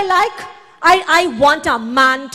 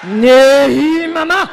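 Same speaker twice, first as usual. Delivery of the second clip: mock Japanese man; slower than usual. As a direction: ham up a Japanese man flirting. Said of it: "you ever hear them talk to you".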